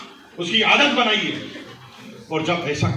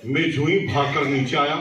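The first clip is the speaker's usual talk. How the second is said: ordinary talk, nothing marked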